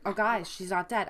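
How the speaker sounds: usual speaking voice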